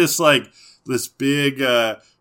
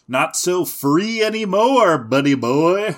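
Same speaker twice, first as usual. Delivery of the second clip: mock taunt; 7 semitones higher, 6 dB louder than usual. Taunting the beaten Butterfree.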